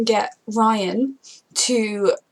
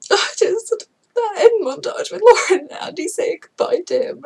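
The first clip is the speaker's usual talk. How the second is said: high, loud, laughing through sobs; crying; 8 semitones higher, 6 dB louder than usual. Real, not acted. Fan recalling the goodbye montage, sobbing. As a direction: choke out the words through tears. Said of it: "I'm just a mess".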